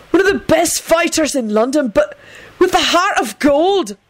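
high, theatrical tribute, slowing at the end; melodramatic. A narrator's reading. Benji eulogises the dead man to his captors, melodramatic. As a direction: lay it on thick, pitched high. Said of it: "Benji pronounced melodramatically".

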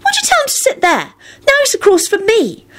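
very high-pitched, put-out complaint; protesting without tact; rude blurt. A narrator's reading. Ginny complaining to Neville about Mundungus, rude. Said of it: "Ginny blurted out rudely".

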